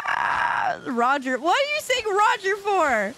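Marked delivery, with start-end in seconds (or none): 1.4-3.1 incredulous. Real, not acted. High incredulous question.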